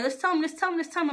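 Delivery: high-pitched, insistent repetition; persistent. Retold or imitated. Imitating auntie pressing for the news, insistent. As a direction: keep pressing without a break, pitched high. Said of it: "she was mad persistent".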